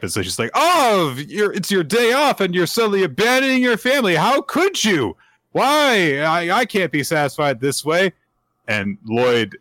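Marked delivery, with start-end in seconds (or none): 0.5-8.1 much higher, hammed-up complaining wife voice; milk the fake grievance in a high wail; overacted high wifely protest; mock aggrieved wife. Yor faking a grievance.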